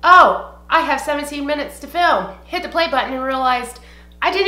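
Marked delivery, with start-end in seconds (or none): 0.0-2.3 retold excited relief. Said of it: "And I was like".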